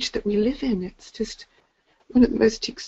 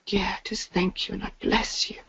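soft, breathy whisper of thanks; 7 dB quieter than usual. Real, not acted. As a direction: whisper your gratitude. Heartfelt closing thanks.

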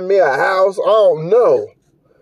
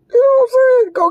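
squeaky, much higher sarcastic question; mock high voice; faster than usual. Ranting about the idle girlfriend, squeaky high mock question. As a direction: jump way up in pitch to mock her. Mocking reaction to lazy girlfriend.

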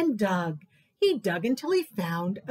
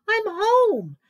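high, loud joyful cry; cheering; 10 semitones higher, 7 dB louder than usual. Acted. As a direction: call out with pure delight. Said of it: "cheered Mole".

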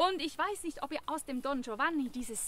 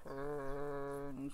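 deep bass voice; 6 semitones lower, 4 dB quieter, much slower than usual. Shown as a demonstration. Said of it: "Noch einen für die tiefen Stimmen".

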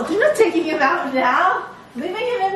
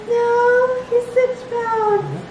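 insistent; 9 semitones higher, much slower than usual. Retold or imitated. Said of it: "And I was like".

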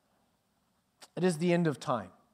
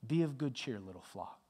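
tender reassurance; 2 semitones lower, 7 dB quieter than usual. Real, not acted.

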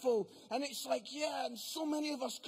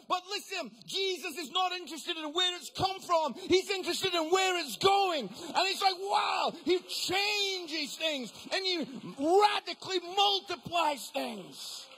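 high, loud, impassioned proclamation; full-force preaching; 5 semitones higher, 8 dB louder than usual. Real, not acted. Preacher answering the doubters about where it's going, full-force preaching. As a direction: preach it loud, stretching the big words.

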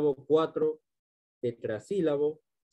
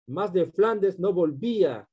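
slow, higher-pitched line of verse; verse recitation; 4 semitones higher, 4 dB louder, slower than usual. Shown as a demonstration. Teacher reciting a rhyme example to students, slowly declaimed. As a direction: declaim it slowly, lifted out of the explanation.

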